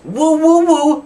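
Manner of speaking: high, loud mock woo-woo-woo; Zack Ryder woo. Wrestling vlogger imitating a wrestler's catchphrase, shrill mock woos. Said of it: "That is the new catchphrase".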